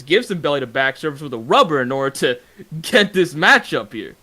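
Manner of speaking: ordinary talk, nothing marked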